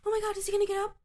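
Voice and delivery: high, breathless fan gasping at the screen; mocking excited girls. Imitating the chatty girls at the movie, high and thrilled. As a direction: squeal it like a gripped moviegoer. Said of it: "they'd be like".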